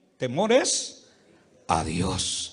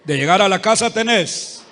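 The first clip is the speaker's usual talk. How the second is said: defiant taunt; 3 semitones higher, 7 dB louder than usual. Acted. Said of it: "Dígale al que está a la par suya".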